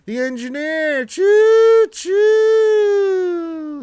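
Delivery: a loud, high choo choo like a steam whistle; train whistle call. Retold or imitated. Streamer greeting the viewer called The Engineer, loud high train whistle.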